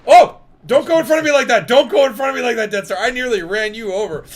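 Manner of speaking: loud, high-pitched fright; real startled outburst, easing slightly by the end; startled scolding. Real, not acted. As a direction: yell at someone who just scared you. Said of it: "Oh, you scared me".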